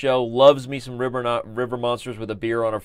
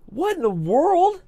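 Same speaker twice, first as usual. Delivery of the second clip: very high, drawn-out disbelief; astonished; 3 dB louder, slower than usual. Real, not acted. Streamer discovering ten-second chess, utterly astonished. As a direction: stretch it out high in amazement.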